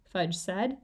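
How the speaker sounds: ordinary delivery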